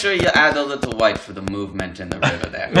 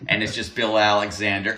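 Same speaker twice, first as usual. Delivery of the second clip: ordinary talk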